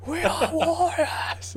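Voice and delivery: slow, high, drawn-out battle cry; rally them like Leonidas; booming defiant gladiator. Retold or imitated. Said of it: "the three hundred speech with Leonidas".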